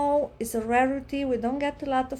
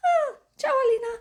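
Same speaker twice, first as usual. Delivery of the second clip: much higher, fast jolly greeting; cheery drunk groundskeeper; 11 semitones higher, 4 dB louder, much faster than usual. Retold or imitated. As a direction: play the tipsy old caretaker, cheery. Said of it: "and I was like".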